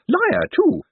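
put-on very high, gleeful old woman; cheerful old lady. A narrator's reading. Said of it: "said Missus Lavish cheerfully".

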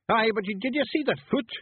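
excited high Scots Feegle; squeaky Scottish disbelief. Acted.

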